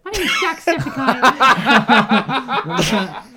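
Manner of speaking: loud, high-pitched mock Irish; hammed-up Irish YouTuber shout; Jacksepticeye. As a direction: belt out a drawn-out cartoon Irish intro, breaking into laughter. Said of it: "Can you do an Irish accent?".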